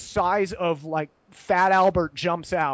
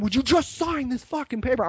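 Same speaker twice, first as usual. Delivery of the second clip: raised-pitch mock exasperation; losing their mind; 5 semitones higher than usual. Police pressing a tourist to sign, mock frantic exasperation. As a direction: imitate fed-up officers pleading in higher voice. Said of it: "they're, like, losing their mind".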